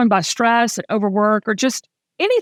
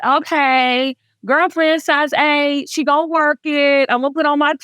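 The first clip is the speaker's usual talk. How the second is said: put-on strut it like a girlfriend cheering herself on; on fire; 5 semitones higher than usual. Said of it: "was so on fire about it".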